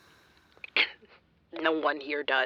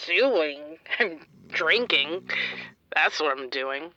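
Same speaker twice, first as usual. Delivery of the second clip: ordinary talk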